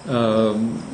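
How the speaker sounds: ordinary talk, nothing marked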